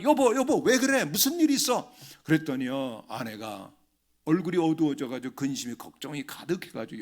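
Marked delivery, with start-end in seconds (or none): 0.0-1.9 high, loud alarmed calling to his wife; startled husband calling out in a rush of worry. Retold or imitated. Husband finds his wife pale at home, alarmed calling. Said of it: "제가 너무 놀라".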